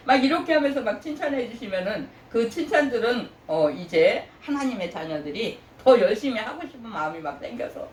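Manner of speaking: normal talking voice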